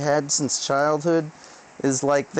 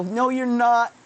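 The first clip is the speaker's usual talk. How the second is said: defiant retort; 6 semitones higher than usual. Retold or imitated. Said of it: "And I go".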